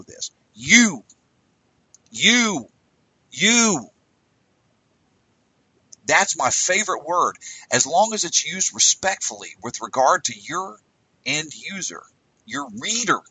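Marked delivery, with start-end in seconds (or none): none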